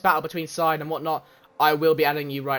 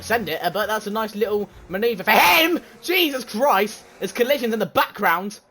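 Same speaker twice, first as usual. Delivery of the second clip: fast, lively play-by-play calling the race; race commentary; 5 semitones higher, 4 dB louder than usual. Acted. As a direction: call every overtake like a live broadcaster.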